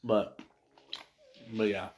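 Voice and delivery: talking while crunching ice; words around a mouthful of ice. Real, not acted. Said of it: "I'm crunching on ice right now".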